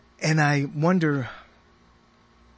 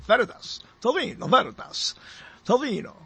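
put-on Veritas with a light Bela Lugosi accent, pitched higher; 4 semitones higher than usual. Host hamming up the name for listeners in a Bela Lugosi accent. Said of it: "almost like Bela Lugosi".

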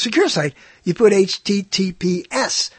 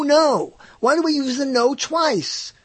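ordinary delivery

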